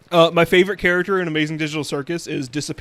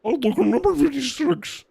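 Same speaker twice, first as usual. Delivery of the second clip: Orange Man; 6 semitones higher, 4 dB louder than usual. Retold or imitated. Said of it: "He's like".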